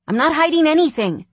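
loud, high, indignant denial; forceful protest to a sibling; demanding. A narrator's reading. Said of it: "Chase demanded".

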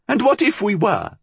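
high-pitched defiant retort; high, challenging old crone; defiant old woman. A narrator's reading. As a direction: throw it back at the accuser.

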